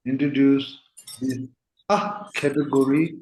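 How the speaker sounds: ordinary talk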